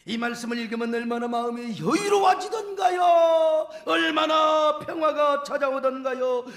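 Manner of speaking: declaimed psalm building to loud exhortation; fervent preaching. Real, not acted. Preacher recites the psalm to comfort the congregation, fervent preaching. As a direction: preach scripture with swelling force.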